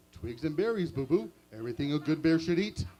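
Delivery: proud Yogi; Yogi Bear voice. Acted.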